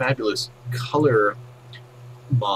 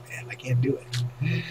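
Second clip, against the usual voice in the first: usual speaking voice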